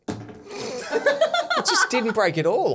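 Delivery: laughing through the words; slow, breaking into laughter. Real, not acted. Can't break the hard biscuit.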